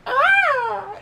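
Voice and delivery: very high, loud "ah"; mimicked squealing cry; imitated kid's yell. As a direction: burst out with a high goofy holler. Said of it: "he comes up for air, and he goes".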